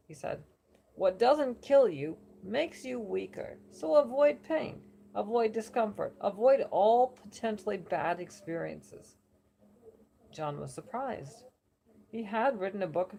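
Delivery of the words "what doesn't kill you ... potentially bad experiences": loud, slow, higher New York oracle pronouncement; booming mock New York decree; bad New Yorker accent. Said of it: "New Yorker accent".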